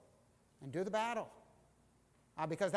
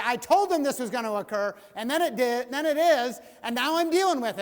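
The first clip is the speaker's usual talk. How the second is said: higher and louder, exasperated told-you-so; mock frustrated rant; 6 semitones higher, 12 dB louder than usual. A frustrated leader venting at people who ignored him, loud and high. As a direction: vent like a leader proven right.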